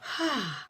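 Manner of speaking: a soft, released out-breath; sigh of relief. Shown as a demonstration. Tension leaves the conversation, soft relieved sigh. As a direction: let the tension drain out in one sigh. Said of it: "There's kind of a".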